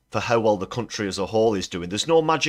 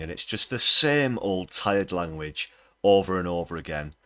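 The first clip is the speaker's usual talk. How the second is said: ordinary delivery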